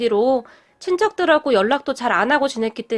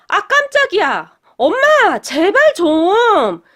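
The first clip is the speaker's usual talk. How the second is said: startled yelp, higher and louder, slowing on the plea; 7 semitones higher, 6 dB louder, much slower than usual. Retold or imitated. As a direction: jump with fright, then beg mom to stop. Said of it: "깜짝 놀란 도련님이".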